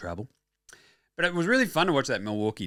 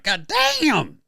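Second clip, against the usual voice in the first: excited exclaim; 11 semitones higher, 4 dB louder than usual. Retold or imitated. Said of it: "I'm like".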